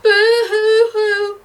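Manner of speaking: high, slow, wailing boo-hoo; long drawn-out childish boo-hoo; child crying. A narrator's reading. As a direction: sob it out like a small child. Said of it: "heard the crying again".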